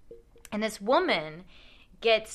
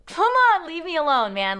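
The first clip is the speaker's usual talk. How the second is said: high, loud exasperated plea; fed-up driver begging the scammer to leave; 8 semitones higher, 7 dB louder than usual. Retold or imitated. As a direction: push her off, fed up. Pleading with scammer.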